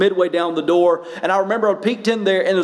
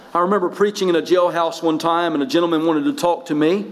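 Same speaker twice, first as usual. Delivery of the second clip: ordinary delivery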